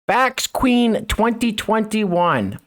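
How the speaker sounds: slow, grand mocking read of a title. Commentator reading a woman's sash aloud, slow mocking read-out.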